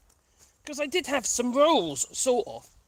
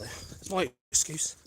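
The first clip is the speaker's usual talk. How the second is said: usual speaking voice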